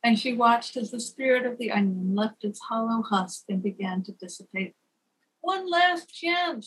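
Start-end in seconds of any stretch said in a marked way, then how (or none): 5.4-6.6 high, slow pleading cry; begging cry. A narrator's reading. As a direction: beg it out desperately. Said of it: "she cried out, begging".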